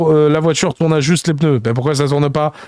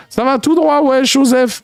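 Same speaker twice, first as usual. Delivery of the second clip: urgent; 8 semitones higher, slower than usual. Acted. Rapper urging driver.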